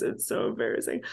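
whispered, embarrassed aside. Real, not acted. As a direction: whisper it, mortified. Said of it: "I can't even say this with a straight face".

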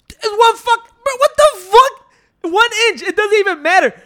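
high, loud furious yell, dragged out; angry shout. Retold or imitated. Yelling at the old man over one inch, furious and loud. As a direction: blow up at the old neighbor, yelling. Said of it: "it already pissed me off".

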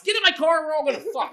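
high, loud brash call; mock bold shout. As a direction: bark it like a cocky guy. Imagined boast.

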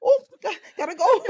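high, loud mock exit line; laughing, squeaky-high mimicry; Irina impression. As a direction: imitate a hasty exit while cracking up. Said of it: "Irina said".

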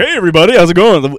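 high, loud, bright DJ greeting; peppy radio host. Shown as a demonstration. Showing how a radio host should greet everybody, loud and high. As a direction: shout it out like a morning DJ. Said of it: "not like radio, like".

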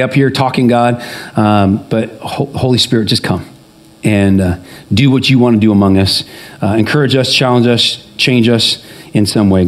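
low-pitched, earnest public prayer; spoken prayer. Real, not acted. Pastor asking God to move among the congregation, low prayerful voice.

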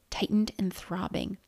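plain reading voice

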